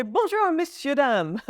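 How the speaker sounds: Bonjour said in French, rising high at the start, then messieurs, dames; French greeting. Shown as a demonstration. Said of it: "you could even say".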